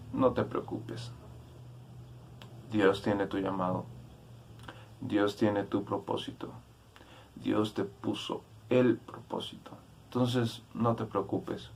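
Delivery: hushed, comforting delivery; soft reassurance. Real, not acted. Preacher telling listeners not to worry about their calling, softly reassuring.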